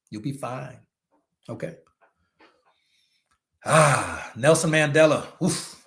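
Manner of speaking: usual speaking voice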